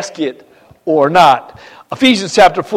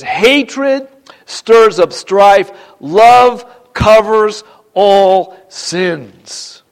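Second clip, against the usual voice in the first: very slow, weighty verse reading; emphatic scripture reading; 3 semitones higher, 3 dB louder, much slower than usual. Real, not acted. Preacher reading Proverbs to the congregation, slow and emphatic. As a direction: space out each phrase with emphasis.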